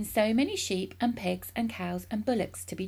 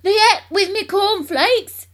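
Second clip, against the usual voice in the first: high, loud, slow outburst; explosive impatience; excited cry; 13 semitones higher, 15 dB louder, slower than usual. A narrator's reading. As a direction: roar it dismissively. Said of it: "cried Mister Cranky".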